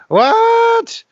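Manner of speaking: very high, loud, drawn-out disbelief; incredulous shout. Real, not acted. Host reacting to the brother's cheeky request, incredulous. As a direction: yelp it out in outraged amazement. Disbelief at [name] asking [name].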